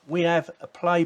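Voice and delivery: usual speaking voice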